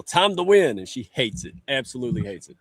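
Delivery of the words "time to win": mild mock yell, raised pitch; retold yell. Dad answering daughter who asks the time, retold yell. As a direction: call it out as a half-strength yell. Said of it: "And I yell".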